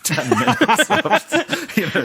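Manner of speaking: high, laughing through it. Real, not acted. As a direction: giggle out the words. Laughing at own story.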